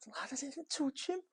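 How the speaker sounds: high, imitated Scouse accent; squeaky mock Liverpool Scouse accent; Steven Gerrard Scouse accent. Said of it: "In his wee Scouse accent".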